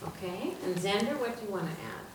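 usual speaking voice

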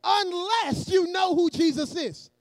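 loud, emphatic sermon delivery; forceful preacher cadence; preaching. Real, not acted. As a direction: preach it to a congregation in measured phrases. Preaches to the congregation.

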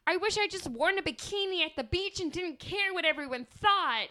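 high, loud mocking whine; high loud mock regretful voice. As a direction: mimic them in a mock whine. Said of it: "really fat people will be like".

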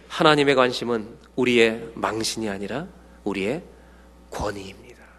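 normal talking voice

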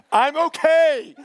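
high, slow, forced-bright "I'm okay"; sing-song mock cheerfulness hiding hurt; fake okay. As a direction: chirp it out like you're totally fine. Acted denial in high voice.

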